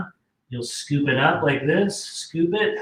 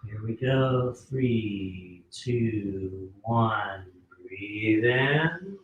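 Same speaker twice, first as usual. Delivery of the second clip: guided breathing; 4 semitones lower, much slower than usual. Acted. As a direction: breathe with them, calm and unhurried. Leads a breathing exercise.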